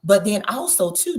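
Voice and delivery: normal talking voice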